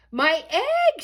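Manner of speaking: high, loud excited shout. Acted. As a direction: cry out in joy. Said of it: "shouted Horton".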